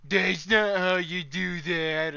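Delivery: slow, mocking sarcastic voice; mock the person talking; sarcastic mockery. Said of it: "sarcasm face".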